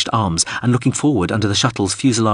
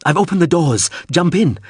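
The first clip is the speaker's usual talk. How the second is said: higher-pitched, urgent call; urgent shout; 8 semitones higher, 3 dB louder than usual. A narrator's reading. Pilot calls to Hawser to jump in, urgent shout. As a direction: shout it to get them aboard. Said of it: "Mip shouted".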